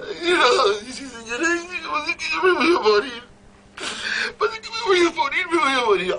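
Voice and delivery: high, crying wail; hammed-up wailing maid. Maid wailing to her employer, high mock sobbing. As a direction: sob it out like a panicked maid.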